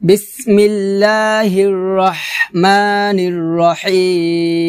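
slow, melodic tajweed chant with long held vowels; Quran recitation. Real, not acted. Teacher opening a Surah Yasin lesson, slow Quran recitation. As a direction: recite it slowly, drawing out each long syllable.

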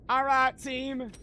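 high, drawn-out call to the team; rally them with long, stretched words. Pretend. Player rallying the team, high slow holler.

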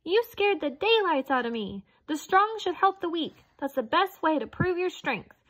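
high squeaky mouse voice; high little mouse scolding. Acted. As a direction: squeak up at the big lion.

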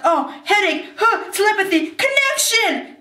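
mock-dramatic revelation; hammed-up telepathy. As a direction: act out a sudden psychic link, pitch rising step by step. Acting out a telepathy attack.

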